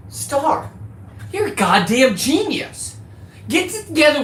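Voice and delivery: high, slow, delighted praise; excited praise for wife Star. Real, not acted. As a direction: gush over the idea. Praising wife's idea.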